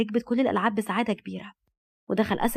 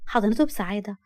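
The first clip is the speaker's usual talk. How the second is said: ordinary delivery, nothing marked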